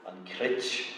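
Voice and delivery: slow, measured recitation of an Irish line; reciting Irish verse. Shown as a demonstration. Lecturer reciting the poem's opening line, slow and measured. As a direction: say it like the opening of an old Irish poem. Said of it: "poem that begins".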